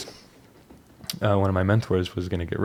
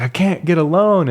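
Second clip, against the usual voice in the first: exasperated; 9 semitones higher, 5 dB louder than usual. Retold or imitated. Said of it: "And I was like".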